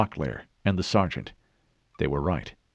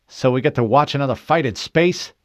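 higher, louder, angry challenge; hissed in mock anger, fully voiced; 8 semitones higher, 7 dB louder than usual. A narrator's reading. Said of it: "he hissed".

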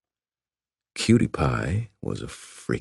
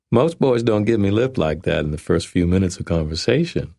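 put-on higher, playful young woman; 3 semitones higher than usual. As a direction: tease him like a Southern belle.

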